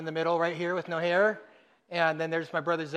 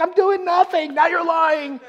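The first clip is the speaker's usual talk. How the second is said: brother protesting; 11 semitones higher, 7 dB louder, slower than usual. Retold or imitated. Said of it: "And he's like".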